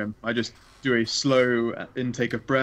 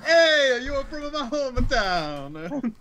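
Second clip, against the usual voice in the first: high, hammed-up Italian hometown greeting; joking Italian, pitched way up and drawn out; mock Italian accent; 13 semitones higher, much slower than usual. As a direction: burst out in a cartoon Italian accent. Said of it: "I can't pull an Amy at Italiano".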